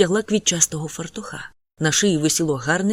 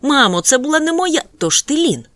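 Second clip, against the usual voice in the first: high, loud outburst; mother interrupting her son; exclaiming; 9 semitones higher, 6 dB louder than usual. A narrator's reading. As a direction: burst out and cut him off. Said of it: "вигукнула вона".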